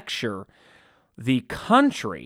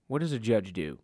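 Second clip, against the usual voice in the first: softer repeat of the question; quiet echo; 4 semitones lower, 7 dB quieter than usual. Shown as a demonstration. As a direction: drop the voice and ask it again to yourself.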